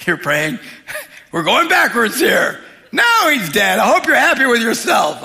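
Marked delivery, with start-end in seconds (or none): 1.3-5.2 high, loud mock accusation; negative Nelly. Negative onlooker blaming Jesus for the death, loudly scolding. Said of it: "people just negative Nellies".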